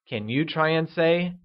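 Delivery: usual speaking voice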